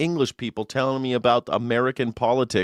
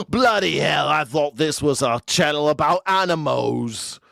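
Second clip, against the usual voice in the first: mock English accent; 4 semitones higher than usual. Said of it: "They're like".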